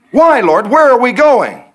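high, loud pleading; pleading questions. Retold or imitated. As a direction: beg God for answers. Believer questioning God.

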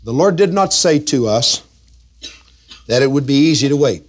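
ordinary talk, nothing marked

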